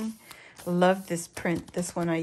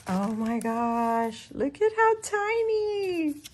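slow coo rising into a high squeal; delighted squeal; 6 semitones higher, slower than usual. Real, not acted. Crafter showing viewers something tiny, squealing with delight. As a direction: stretch it out, melting at the cuteness.

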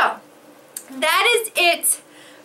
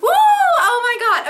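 laughing with joy; 6 semitones higher, 4 dB louder than usual. Real, not acted. Joy at unboxing a gift.

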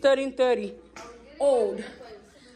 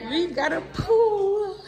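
high, slow, laughing delight; excited; 7 semitones higher, slower than usual. Real, not acted. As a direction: squeal it out with joy. Thrilled to see a pool.

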